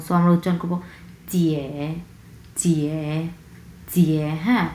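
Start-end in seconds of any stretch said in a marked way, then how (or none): 1.3-4.3 চিয়ে low, dipping in the voice; third tone. Shown as a demonstration. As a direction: sink low and stay down on চিয়ে. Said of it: "তিন number tone".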